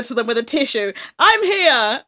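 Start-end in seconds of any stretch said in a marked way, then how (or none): 1.2-2.0 high-pitched mock heroic announcement; hammed-up, pitched-up arrival; overeager rescuer. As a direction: announce your arrival in comic over-eagerness. Acting out sprinting over with tissue.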